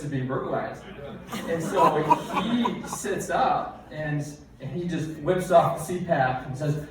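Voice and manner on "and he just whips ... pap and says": ordinary talk, nothing marked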